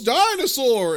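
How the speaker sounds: high, loud playful yell; mock shout. Retelling a movie gag, a high mock shout. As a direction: shout it like a cartoon jump.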